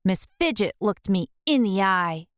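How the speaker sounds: ordinary delivery, nothing marked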